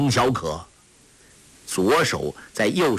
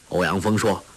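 usual speaking voice

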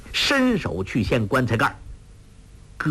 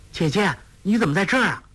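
high, fast surprised question; surprised girl; 8 semitones higher, much faster than usual. A narrator's reading. 黄蓉 asks 穆念慈 why she is here, surprised. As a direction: ask her eagerly, startled.

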